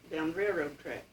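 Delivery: ordinary delivery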